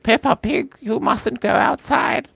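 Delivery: high, put-on British cartoon voice; Peppa Pig. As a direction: pitch it way up, British and drawn out. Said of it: "A soft British accent".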